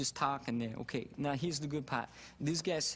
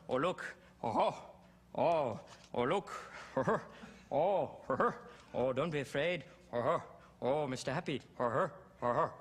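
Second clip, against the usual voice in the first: senile comic firing off random oohs and exclamations; old funnyman's disjointed little bursts, delighted by nothing; Robin. Acted. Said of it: "I was doing Robin like senile".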